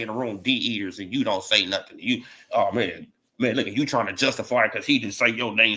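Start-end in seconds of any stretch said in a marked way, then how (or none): none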